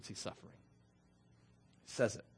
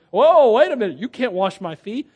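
loud startled "Whoa" from Peter; Peter protesting; 4 semitones higher, 9 dB louder than usual. Acted. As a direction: hold it, you can't do that, loudly.

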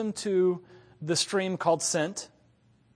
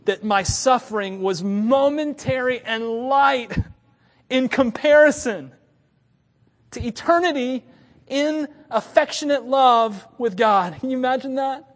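rising preaching fervor; 7 semitones higher, 5 dB louder than usual. Real, not acted. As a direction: lift each phrase higher, slowing as it swells. Preacher building to a climax.

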